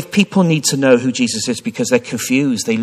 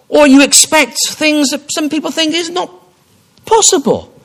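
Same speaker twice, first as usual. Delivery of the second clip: much higher, louder, building plea; impassioned appeal; 11 semitones higher, 6 dB louder than usual. Acted. Preacher urging the congregation to surrender, rising high and loud. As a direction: climb to a fervent peak.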